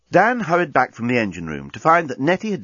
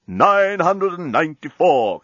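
talking bomb; 5 semitones higher, slower than usual. Acted. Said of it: "continued the bomb".

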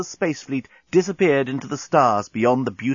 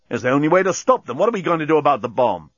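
fast, impatient retort; a bit short-tempered; 4 semitones higher, 3 dB louder, faster than usual. A narrator's reading. Dan pressing the captain about the bomb, short-tempered. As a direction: hurry it out testily. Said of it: "getting a bit short-tempered".

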